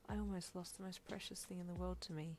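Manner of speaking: soft, lightly wobbly voice. A narrator's reading. Hawkes nearly lost his loved one, soft wobbly voice. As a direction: confess it softly, a little wobble in the voice. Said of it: "he said in a wobbly voice".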